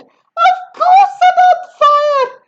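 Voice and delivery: very high, loud indignant yell. A narrator's reading. Grandma yelling at George, high loud yell. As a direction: snap back furious. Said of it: "she yelled".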